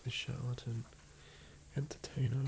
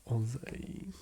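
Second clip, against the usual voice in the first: louder, broad hammed-up Australian accent; true blue Aussie; 4 dB louder, much slower than usual. Australian player joking about northern Aussie speech, broad accent. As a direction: boast it like a stage Aussie. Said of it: "I don't really speak like that".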